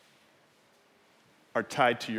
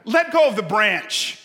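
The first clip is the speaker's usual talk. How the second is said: loud, raised order from above; voice from heaven commanding; 10 semitones higher, 9 dB louder than usual. Acted. God tells the man on the branch, commanding. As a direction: play God giving a firm order, loudly. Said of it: "voice from heaven comes back".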